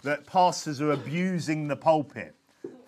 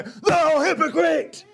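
slow, high, full-voiced shout; hard-preaching shout; 9 semitones higher, 4 dB louder, much slower than usual. Shown as a demonstration. Preacher showing how Jesus rebuked hypocrites, shouted high and slow. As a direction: thunder it out like a fiery rebuke. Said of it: "could read him just go".

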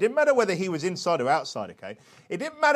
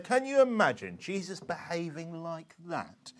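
slow, softened mock dismay; lightly voiced old pastor, drawn out and hushed; disapproving old pastor; 7 dB quieter, slower than usual. Preacher imitating a disapproving old pastor, slow and soft. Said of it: "An old pastor once said this to me".

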